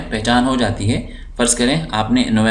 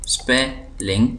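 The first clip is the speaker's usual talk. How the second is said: Spelling slowly as SPE, ling; syllable by syllable; slower than usual. Shown as a demonstration. Teacher showing two syllables, spelling slowly split. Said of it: "दो syllable हैं".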